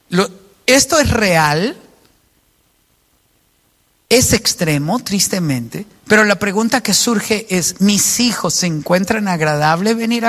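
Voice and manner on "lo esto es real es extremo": ordinary talk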